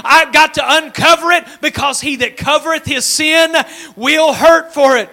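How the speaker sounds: loud, high, full-force preacher's cry; a revival preacher pressing the crowd; fiery preaching. Acted. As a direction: preach it out loud to the whole room.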